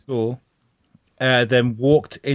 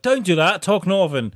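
higher-pitched objection, acted; mock pleading; 6 semitones higher than usual. Fan telling actor to talk Northern, mock pleading. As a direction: protest like a let-down fan.

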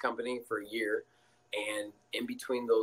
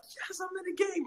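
cheering. Retold or imitated. Cheering his own entry.

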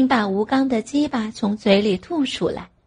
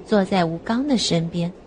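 plain reading voice

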